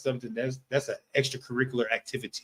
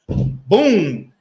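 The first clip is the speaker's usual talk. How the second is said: a punchy, louder mock "Boom"; 3 semitones higher, 8 dB louder than usual. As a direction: slam down "Boom" like serving a feast. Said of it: "Christ might see this lion and be like".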